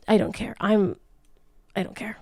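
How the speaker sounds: ordinary talk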